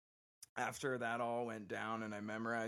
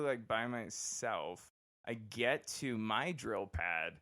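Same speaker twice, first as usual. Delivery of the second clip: ordinary delivery, nothing marked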